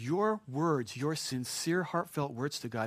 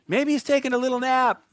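higher, sing-song mocking voice; taunting; 9 semitones higher, 7 dB louder than usual. Elijah teasing that their god is napping, high and mocking. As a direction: rib them like a playground tease. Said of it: "Elijah began to taunt them".

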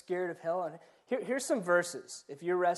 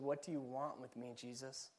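much softer, fading question; a confused man asking Jesus softly; unsure question; 3 semitones lower, 12 dB quieter than usual. Retold or imitated. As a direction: ask it quietly, not quite knowing. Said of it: "he didn't quite know".

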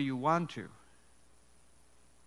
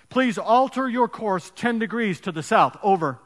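captain on radio; 5 semitones higher, 8 dB louder than usual. Acted.

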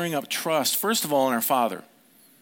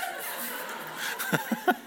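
real squeaky laugh; high laugh; 6 dB quieter than usual. Real, not acted. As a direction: let the laugh escape. Laughing at interruption.

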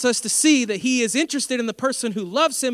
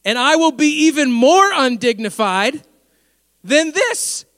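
louder, slow, rising triumph; loud and slow, climbing to the end; defiant proclamation; 5 semitones higher, 8 dB louder, slower than usual. Retold or imitated. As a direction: proclaim it as the king who won't be shut up. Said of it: "here it is, ready?".

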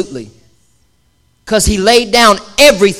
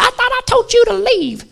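much higher, softer scolding mama voice; girl's mama; 13 semitones higher, 4 dB quieter than usual. Retold or imitated. Girl's mother scolding the boyfriend at the door, high mock voice. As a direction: falsetto mock mother telling him off. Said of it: "the girl's mama comes out and said".

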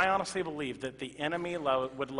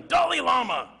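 mock yell; 9 semitones higher, 8 dB louder than usual. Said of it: "I don't hear anybody yelling".

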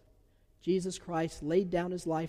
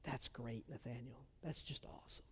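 hushed, much softer and lower reply; quiet awe; 4 semitones lower, 16 dB quieter than usual. Retold or imitated. Pastor answers a boy's adoption news, quietly moved. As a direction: say it softly, almost under the breath.

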